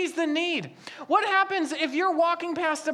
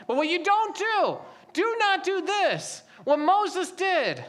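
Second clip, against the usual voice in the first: usual speaking voice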